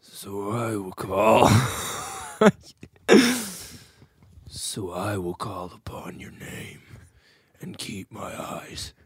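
low, slow, hushed Thanos rumble. Retold or imitated. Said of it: "in the voice of Thanos".

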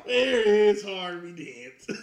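slow words through giggles; can't stop laughing. Real, not acted. Cracking up mid question.

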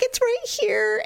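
put-on high, meek little-kid reply; small child voice. Girl showing her parents, high meek kid voice. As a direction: pipe it up like a scared kid. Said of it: "I'm like".

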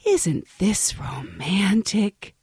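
slow, breathy whisper. Retold or imitated. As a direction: whisper it slowly and intimately. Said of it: "he says to me".

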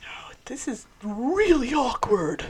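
slow, breathy awkward whisper. Acted. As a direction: whisper it back, embarrassed. Angel whispering back, embarrassed.

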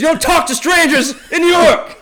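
loud, high mock shout of a fed-up friend; exasperated scolding. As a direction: yell the rule at him, imitating exasperation. Acts friend's exasperated refusal.

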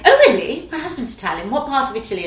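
chatty delight. Retold or imitated. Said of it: "she said".

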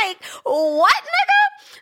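very high, loud acted disbelief; re-enacted outraged shriek; incredulous outburst. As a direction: yell it in mock disbelief.

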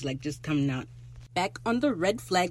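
usual speaking voice

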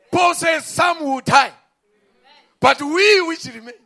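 preacher shouting the promise of the rapture; preaching at full force. Real, not acted.

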